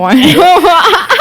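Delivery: words dissolving into a real laugh; laughing agreement. Real, not acted. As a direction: agree while breaking into laughter.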